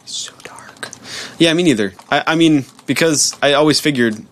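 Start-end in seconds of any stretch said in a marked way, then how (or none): 0.0-0.9 breathy whispered aside. Real, not acted. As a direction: breathe it out under the voice.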